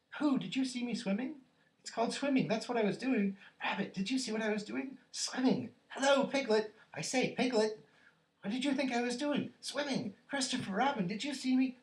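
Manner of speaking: put-on very high, loud, piping child; relentless thrilled squealing; excited squeaky Roo. A narrator's reading. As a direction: clamour for everyone's attention. Roo boasting excitedly to everyone.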